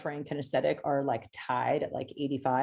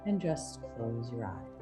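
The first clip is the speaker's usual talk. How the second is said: hypnotic induction; 5 dB quieter than usual. Acted. Reads hypnotic induction over music.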